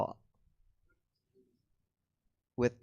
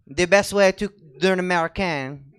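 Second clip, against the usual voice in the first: mock French accent, louder; imitating French accent; 5 semitones higher, 6 dB louder than usual. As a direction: parrot her French accent. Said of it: "doing a really good fake French accent".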